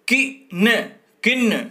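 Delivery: पिन called out high and loud, said twice; sounded out for pupils. Shown as a demonstration. Teacher to young pupils, calling out पिन loudly. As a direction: call out पिन clearly, like a teacher drilling a class. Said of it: "तो क्या शब्द बनेगा?".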